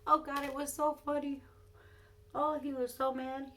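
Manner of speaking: delighted recollection. Real, not acted. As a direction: gush about how funny it was.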